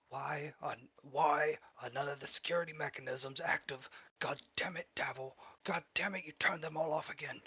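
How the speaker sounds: exasperated, cursing boss voice; angry villain. Acted. Angry boss voiced in game scene.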